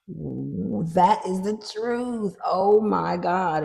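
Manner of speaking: awestruck, savoring the revelation; real awe. Real, not acted. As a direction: stretch each word out in wonder. Awed reaction to the card drawn.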